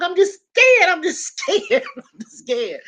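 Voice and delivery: high-pitched wail, tapering down; mock crying fear. Sister crying to her brother, mock scared wail. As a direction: wail it hammed-up, then let it sink. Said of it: "What you crying for?".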